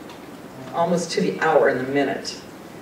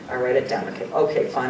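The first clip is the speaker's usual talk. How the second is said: usual speaking voice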